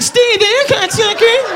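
high, slow squeaky little voice; Doughboy griping to his friend, high and slow. Retold or imitated. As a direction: voice a tiny dough creature, slowly. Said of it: "The Pillsbury Doughboy's like".

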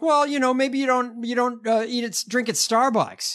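high-pitched condescending impression; mocking rich investor. Rich investor scolding struggling people, mocking imitation. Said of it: "He's like".

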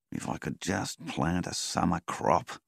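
plain reading voice

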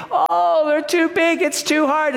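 high-pitched mock whine; mock complaining. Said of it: "they grumble and complain".

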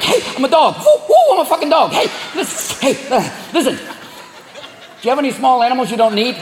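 a coyote on crack sweet-talking you; coked-up coyote. Acted. Coyote in the yard hustling for small pets, wired. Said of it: "They come into your yard looking like".

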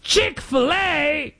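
put-on a tourist's British accent in awe; hammed-up British accent; bad British accent. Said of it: "My accents are awful".

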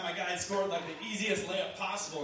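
usual speaking voice

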